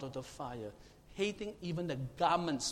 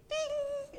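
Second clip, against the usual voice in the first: playful ding; 4 dB louder than usual. Pretend. Mock magic sound of miracle.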